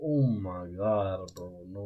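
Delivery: slow, stunned disbelief; reading in disbelief. Real, not acted. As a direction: drag it out in shock. Reacting to her photo.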